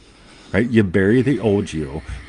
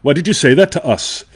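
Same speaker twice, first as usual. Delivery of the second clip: quoting the show; 7 semitones higher, 4 dB louder than usual. Retold or imitated. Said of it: "they talk about things like".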